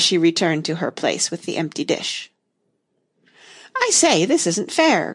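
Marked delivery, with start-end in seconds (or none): 3.8-5.1 cried. A narrator's reading. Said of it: "cried the unicorn".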